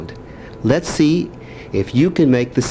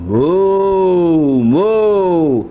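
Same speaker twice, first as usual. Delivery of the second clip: loud, drawn-out mooing; cow moo; 4 semitones higher, 4 dB louder than usual. Shown as a demonstration. Grandpa mooing for his grandson. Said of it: "What's the cow say".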